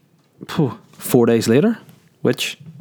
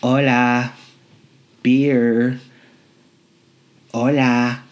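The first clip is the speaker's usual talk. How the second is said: mock Spanish; much slower than usual. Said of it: "all I can say is".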